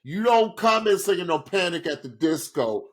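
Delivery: high, emphatic scolding; mock outrage. Real, not acted. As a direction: scold him in mock disbelief. Mock outrage at song choice.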